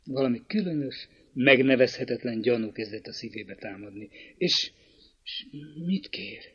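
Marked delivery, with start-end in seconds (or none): 4.4-6.4 hushed and much softer, voice clouding over; veiled voice. A narrator's reading. Girl asking her brother what the suitor wants, in a veiled voice. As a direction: ask it with a veiled, faltering softness. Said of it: "fátyolozott hangon".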